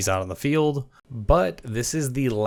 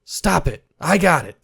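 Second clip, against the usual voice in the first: much higher, louder mock stop it; retold shout; 7 semitones higher, 5 dB louder, slower than usual. Coach Wilkes breaking up the argument, higher louder mock shout. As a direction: bark at them to stop, half-acted. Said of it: "he goes".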